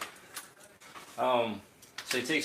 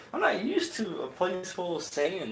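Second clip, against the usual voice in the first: whiny sand complaint; 9 semitones higher than usual. Retold or imitated. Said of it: "It's like".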